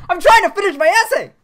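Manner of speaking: fast, high, loud exasperated yell; mock outcry. Mock protest at unwanted advance.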